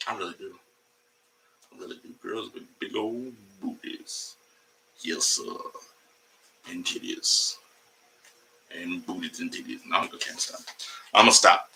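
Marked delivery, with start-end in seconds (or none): 0.1-5.4 much softer, low confiding; softly insisting to the camera; hushed aside. Pretend. Sudden hush after claim. 6.7-10.6 unvoiced whispered aside; whispering to the stream. Whispered continuation.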